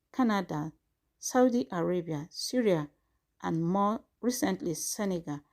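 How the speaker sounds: measured, formal news read; formal statement reading. Acted. Reads out formal press statement.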